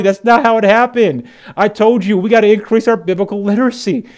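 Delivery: loud, high, driving sermon voice; full-force preaching. Acted. Pastor correcting the congregation, loud fervent preaching.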